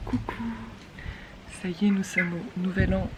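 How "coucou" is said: whispering. Real, not acted. Whispered hello on silence day.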